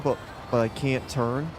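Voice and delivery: ordinary talk, nothing marked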